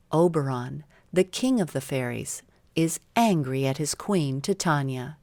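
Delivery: ordinary delivery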